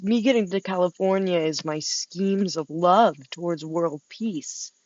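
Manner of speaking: ordinary talk, nothing marked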